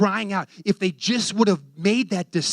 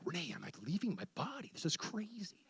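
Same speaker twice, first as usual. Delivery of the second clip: very soft, awed murmur; hushed aside; 17 dB quieter than usual. Retold or imitated. Retells hushed words to wife mid-vision.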